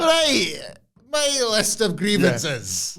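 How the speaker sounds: high, slow mocking whine; mock whiny complainer. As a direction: whine out a list of grievances like a sulking wrestler.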